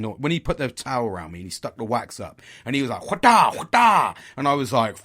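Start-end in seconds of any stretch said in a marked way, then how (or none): 3.0-4.1 loud, high mock yell; imitated barber's cry. Barber ripping wax out of a nose, loud imitated cry. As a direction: shout it high, twice, in sudden bursts.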